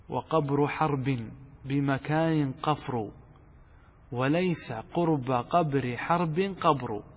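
"وقبر حرب بمكان قفر" recited very slowly; slow recitation. Shown as a demonstration. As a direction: dwell on each "قبر" and "حرب", a heavy measured chant. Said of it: "يقول".

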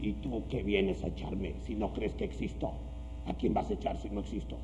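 low, quiet demonic voice. Retold or imitated. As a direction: growl it low like a possessing demon. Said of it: "con voz de demonio".

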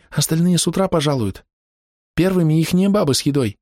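put-on high, eager boy telling; a lightly voiced boy explaining; young boy voice.

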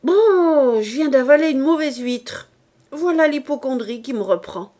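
loud, high outburst; sudden loud outcry over a bad oyster; exclaiming. A narrator's reading. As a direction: burst out loud and high in disgust. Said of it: "Puis il s'exclama".

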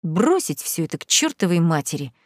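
ordinary delivery, nothing marked

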